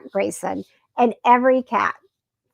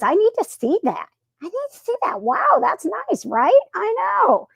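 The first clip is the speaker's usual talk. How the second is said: squeaky cat voice, much higher; Malcolm's cat voice; 12 semitones higher than usual. Acted. Cat owner voicing Malcolm the cat, much higher and eager. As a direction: voice the cat as a high, eager little creature. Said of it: "Malcolm says".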